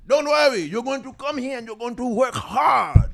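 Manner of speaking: put-on high shouted Haitian accent; loud Haitian parent giving orders. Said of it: "I'm gonna do the Haitian accent".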